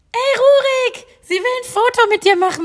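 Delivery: high, loud call across the room; imitating friend calling out. Retelling how her friend called the celebrity over, high calling out. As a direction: mimic a tipsy friend yelling his name. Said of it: "Und Anahita so".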